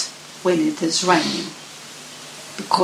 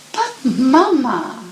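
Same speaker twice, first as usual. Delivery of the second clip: protesting cub; 7 semitones higher, 4 dB louder than usual. Acted.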